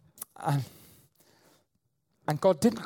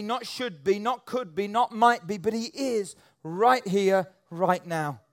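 preaching; 4 semitones higher, slower than usual. Acted. Preaching build on present God.

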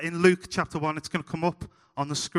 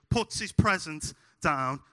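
ordinary talk

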